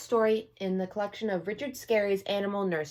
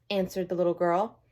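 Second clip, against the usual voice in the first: plain reading voice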